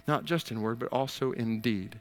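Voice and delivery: ordinary delivery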